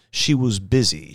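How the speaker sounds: plain reading voice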